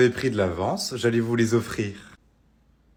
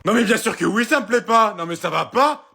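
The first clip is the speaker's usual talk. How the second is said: loud, high-pitched indignation; outraged; 7 semitones higher, 5 dB louder than usual. Acted. Mother-in-law to Laura about an obscene gift, outraged. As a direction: burst out in offended disbelief.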